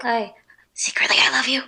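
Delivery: whispered secret confession. Retold or imitated. Girl confessing a secret crush to herself, whispered.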